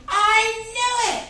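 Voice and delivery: high, drawn-out triumphant shout; yelling. Acted. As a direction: yell it out as a satisfied reader. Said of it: "where you're yelling".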